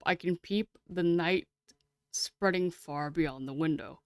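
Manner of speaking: usual speaking voice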